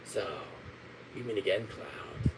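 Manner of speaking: low, menacing villain murmur; deep sinister whisper of a villain; Sephiroth voice. Acted. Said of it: "I can do Sephiroth's voice".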